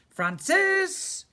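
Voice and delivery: loud high call up the pipes; Dad shouting. A narrator's reading. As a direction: bellow his name from downstairs.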